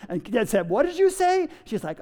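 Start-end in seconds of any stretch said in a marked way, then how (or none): 0.7-1.5 high-pitched imitation of an indignant parent; mock parental challenge; challenging parent. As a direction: pitch it up sharply, demanding a repeat. Parent challenges muttering child.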